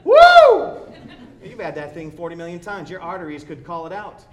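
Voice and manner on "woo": a huge high, loud whoop; whooping cheer. Retold or imitated. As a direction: cheer like the plate is a prize. Diner cheers the same greasy dish.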